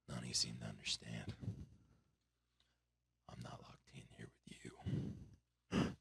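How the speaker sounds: low, hushed menace sinking to a whisper; quiet vigilante threat, almost whispered; Rorschach. Acted. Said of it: "And he's like".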